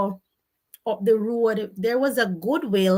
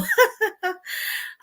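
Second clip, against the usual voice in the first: shrieking laugh; high laugh; 12 semitones higher than usual. Real, not acted. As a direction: let the laugh ring out high.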